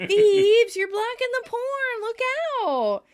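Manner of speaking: high, loud whining complaint; whiny Joey. Retold or imitated. Joey whining at Phoebe who blocks the TV, high and loud. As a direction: whine like a spoiled kid. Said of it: "Joey whines".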